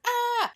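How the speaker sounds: high, loud short scream; mock scream. Scared kid dropping the pot, high mock scream. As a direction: yelp as if startled.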